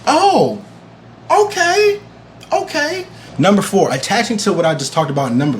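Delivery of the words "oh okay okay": high-pitched, drawn-out reacting okay; retold surprise. Viewer reacting to the episode, surprised. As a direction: let the pitch leap up on each okay.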